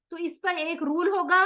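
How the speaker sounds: normal talking voice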